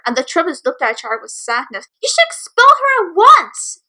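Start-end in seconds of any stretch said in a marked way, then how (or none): 2.0-3.8 much higher, louder, slow yell; demanding yell. Acted. Bully demanding the principal expel her, a loud slow yell. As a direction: insist she be expelled. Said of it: "Dancer yelled".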